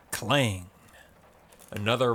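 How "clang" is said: ordinary delivery, nothing marked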